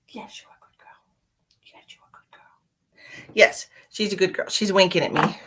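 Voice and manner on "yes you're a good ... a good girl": soft whisper of praise to a cat; whispered pet praise. Real, not acted. Praising her cat Lucky in a tender whisper. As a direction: whisper lovingly to the kitty.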